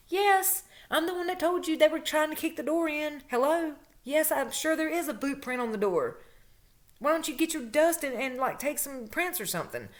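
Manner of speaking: high-pitched, exasperated comeback; indignant retort. Retold or imitated. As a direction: act out fed-up disbelief at being doubted. Acted quarrel with the police.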